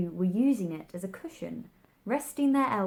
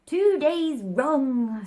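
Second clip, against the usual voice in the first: slow, loud sighing lament; 6 semitones higher, 9 dB louder, much slower than usual. A narrator's reading. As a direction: sigh it out in despair over the watch. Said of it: "sighed the Hatter".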